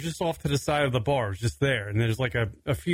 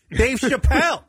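high, loud insistence on the name; exasperated yell; 8 semitones higher, 5 dB louder than usual. Retold or imitated. To drunk friend Jason, an exasperated louder yell.

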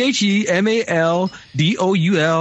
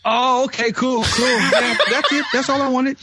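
laughing; 7 semitones higher than usual. Real, not acted. Caller laughing, pleased.

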